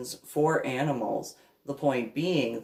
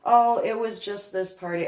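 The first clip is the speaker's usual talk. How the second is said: mock Pam; 8 semitones higher, 4 dB louder than usual. Mimicking Pam shrugging it off.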